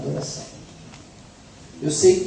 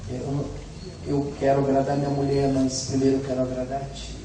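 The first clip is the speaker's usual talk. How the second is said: soft, lower murmured prayer to the Lord; quiet prayer; 5 semitones lower, 7 dB quieter than usual. Retold or imitated. Preacher quietly praying to the Lord, low and humble. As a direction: pray it under your breath, humbly. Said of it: "Eu fico só falando".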